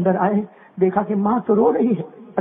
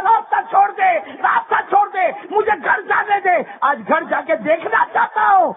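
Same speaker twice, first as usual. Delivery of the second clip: urgent shouted demand; 11 semitones higher than usual. Acted. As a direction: shout to clear the way, desperate to reach home. Abu Hurairah pushes past.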